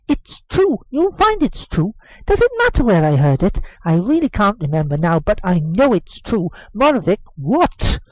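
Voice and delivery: put-on high, eager boy insisting; boy insisting to his nurse; insistent boy. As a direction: insist like a stubborn child.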